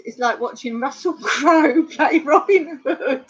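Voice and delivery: real laughter breaking into the words; helpless laugh through the line. Real, not acted. Reading a joke, breaking into laughter.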